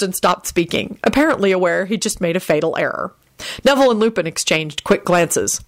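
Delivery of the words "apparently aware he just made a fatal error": usual speaking voice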